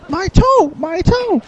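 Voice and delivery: squeaky voice yelping about a stubbed toe; high-pitched Goron voice. Pretend. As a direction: cry out in a squeaky pained voice. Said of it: "really high-pitched voice for a Goron".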